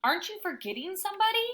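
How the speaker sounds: high, fast, pointed; don't you dare leave me; indignant question. A narrator's reading. Tied-up dog calling after Hattie, high fast indignation.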